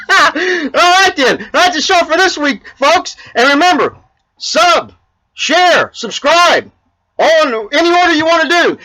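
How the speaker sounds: high, loud host sign-off; host hyping the viewers to subscribe, high and loud; upbeat show sign-off. Acted. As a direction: pump up the sign-off.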